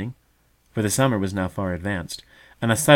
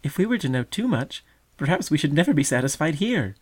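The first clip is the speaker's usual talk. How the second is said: laughing little one; 7 semitones higher than usual. A narrator's reading. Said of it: "laughed the tender little one".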